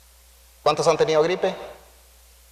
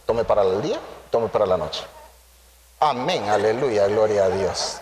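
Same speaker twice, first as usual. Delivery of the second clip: mock pious caller; 10 semitones lower than usual. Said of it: "Ah, pero está malo de la gripe".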